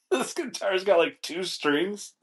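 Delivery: high-pitched, barely past the laugh; helpless amusement at the punchline; cracking up. Real, not acted. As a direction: squeeze the words out between laughs, dragging the last ones. Cracking up at the two-string guitar.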